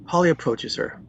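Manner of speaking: normal talking voice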